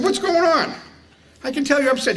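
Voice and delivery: high, teasing mock concern; faux-innocent friend baiting the chief. Teasing the chief in retold story.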